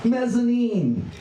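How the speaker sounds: normal talking voice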